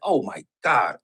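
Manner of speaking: low, hushed retold dismay; quiet dismay. Retelling his look in the barbershop mirror, quiet dismay.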